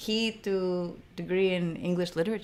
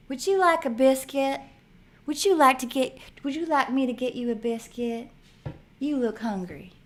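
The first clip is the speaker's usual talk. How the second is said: put-on Southern accent; 5 semitones higher than usual. Host imitating a Southern accent for viewers, Southern accent. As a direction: offer the biscuit in a sweet Southern accent. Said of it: "Southern accent".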